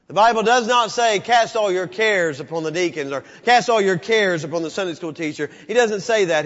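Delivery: loud, high preaching cry; fired-up preacher; full-force preaching. Acted. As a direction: preach it to the back row.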